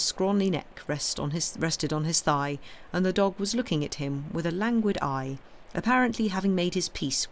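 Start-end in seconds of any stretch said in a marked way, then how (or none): none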